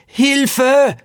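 put-on girl in the water shouting to the captain; desperate cry for help. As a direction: scream it out from the sea. Girl overboard calling for help.

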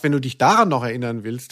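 usual speaking voice

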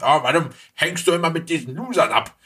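a nagging villain father griping at his son; grumpy cartoon father. Recalling the Raccoons villain scolding his son, fast grumble.

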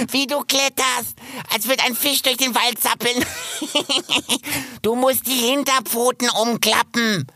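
high giggly squirrel, laughing through the words; teasing laugh between words. Acted. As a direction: laugh at a clumsy climber. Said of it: "lachte Kip".

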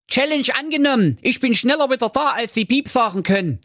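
high, fast and cocky; eager challenge. A narrator's reading. Schnitzelschorsch accepting the challenge, fast and cocky. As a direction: rattle it off, raring to go. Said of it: "Also sprach er".

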